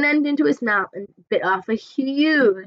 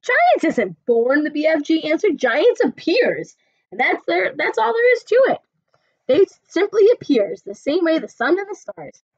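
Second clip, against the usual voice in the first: lightly voiced friendly giant, rustic; a hint of the big friendly giant talking; BFG voice; 7 semitones higher, 5 dB louder than usual. BFG explains to Sophie in a lightly voiced giant manner.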